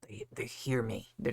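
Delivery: hushed, as if being overheard; paranoid whisper. Real, not acted. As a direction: whisper it so the devices can't hear. Paranoid about devices listening.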